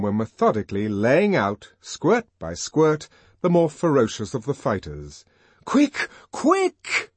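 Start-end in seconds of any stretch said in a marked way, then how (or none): 5.6-7.1 very high, urgent call; frantic high-pitched urging; yelled. A narrator's reading. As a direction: hurry the police along in a panic. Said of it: "yelled Bernard".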